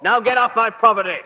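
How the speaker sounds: a furious landowner ordering the police off his lawn; angry shout. Acted. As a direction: drive them off your lawn. Dismissing police angrily.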